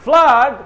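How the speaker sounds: Flood loud and drawn out, rhyming with blood; high, emphatic Flood with an uh-ah vowel. Shown as a demonstration. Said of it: "ব্লাডের মতন করে ফ্লাডটা বলবেন".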